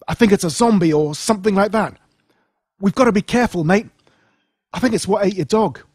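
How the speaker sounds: high, loud and urgent; urgent warning. Acted. Boy warning his friend about the zombie, urgent and loud. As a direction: warn him fast and tense.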